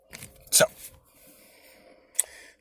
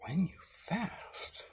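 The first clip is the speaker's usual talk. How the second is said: slow whispered "When you fast"; hushed slow whisper of "When you fast"; whispered scripture; 6 semitones higher, 7 dB quieter, much slower than usual. Shown as a demonstration. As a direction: breathe "When you fast" slowly, like a secret. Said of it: "So Jesus said".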